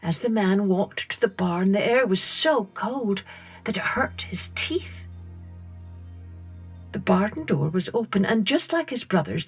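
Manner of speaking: usual speaking voice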